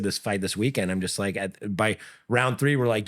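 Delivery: ordinary talk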